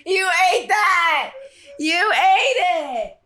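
high, slow, laughing praise. Real, not acted. Praising her cohost's intro, laughing. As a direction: cheer her on through laughter.

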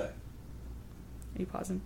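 a real unvoiced whisper. Real, not acted. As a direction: ask it under your breath so the mic won't catch it. Aside while cohost pauses recording.

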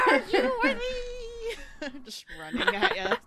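high laughing battle cry. Pretend. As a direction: shriek it through laughter.

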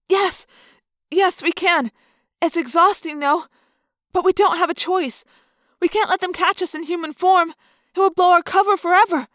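put-on young girl reassuring a friend; Cassie's voice. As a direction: answer quick and sure.

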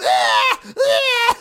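high cartoon crying "ah"; exaggerated, very high fake cry; mock cartoon wail. Imitating SpongeBob's cry.

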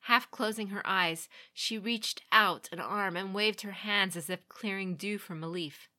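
ordinary delivery, nothing marked

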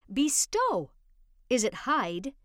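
ordinary delivery

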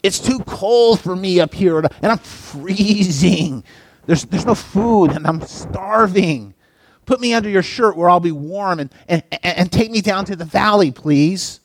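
a wheedling serpent asking for warmth; pleading snake. A narrator's reading. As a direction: beg like a cold, starving snake.

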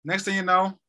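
ordinary delivery

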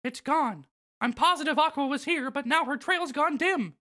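Mickey Mouse voice. Acted. Voicing Mickey in recap.

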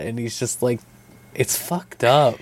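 ordinary talk, nothing marked

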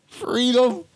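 high, laughing mock outrage; loud cackling scorn; laughing disbelief.

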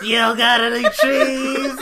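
loud, high, drawn-out character voice; hammed-up sitcom neighbor, pitched high; quirky sitcom neighbor. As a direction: play the wacky neighbor, loud and slow.

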